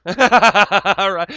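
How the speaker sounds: real laugh, high and loud. Real, not acted. Laughing at his own joke to the stream, loud and high. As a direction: laugh through the words.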